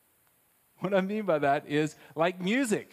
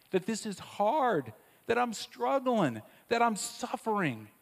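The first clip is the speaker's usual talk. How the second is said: rising plea; 7 semitones higher than usual. Real, not acted. Preacher voices pain rising.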